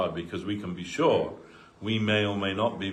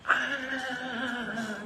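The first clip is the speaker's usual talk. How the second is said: imitated child wail. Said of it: "a child on the floor".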